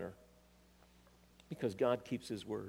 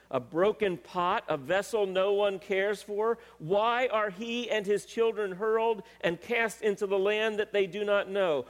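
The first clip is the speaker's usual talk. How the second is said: high, loud prophetic proclamation; preaching scripture at force; 7 semitones higher, 5 dB louder than usual. Acted. Preacher voices God's lament over Conaniah, forceful declamation. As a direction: declaim it with raised pitch and full voice.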